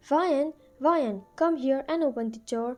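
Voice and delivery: usual speaking voice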